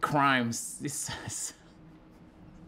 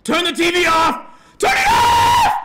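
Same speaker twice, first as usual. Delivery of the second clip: building from raised voice to full scream; screamed thoughts; 13 semitones higher, 11 dB louder than usual. Retold or imitated. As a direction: imitate a man screaming, higher each time. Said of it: "He was just screaming thoughts".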